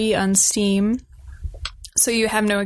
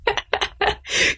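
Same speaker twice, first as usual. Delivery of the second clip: breathy laugh; 12 semitones higher than usual. Real, not acted. Laughs at Twitter joke.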